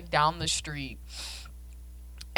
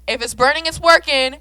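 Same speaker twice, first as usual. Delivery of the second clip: pitched up and loud; loud dismissive stylist; 8 semitones higher, 12 dB louder than usual. Retold or imitated. Hairdresser brushing off the girl, loud. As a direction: shout it off dismissively. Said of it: "turns around and is like".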